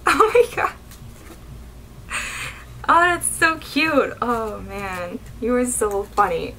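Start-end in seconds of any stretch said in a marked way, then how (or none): 0.1-4.1 high, cooing delight; delighted gushing. Real, not acted. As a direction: coo up high, thrilled by cuteness. Still delighted by the cheese.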